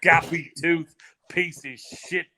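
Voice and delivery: slow, mocking British trash-talk rant; imitating a brawler's insult, high and drawn out; mock Tyson Fury insult. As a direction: ham up the British insult, drawing it out. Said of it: "And then to hear a guy say".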